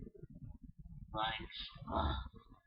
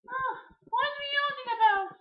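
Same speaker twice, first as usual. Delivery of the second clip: high princess voice; 6 dB louder than usual. Acted. Peach voice again.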